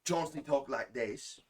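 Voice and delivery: high, loud, slow Cockney accent; Chauncey. Shown as a demonstration. Showing friends how a character talks, high Cockney. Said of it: "He had a Cockney accent".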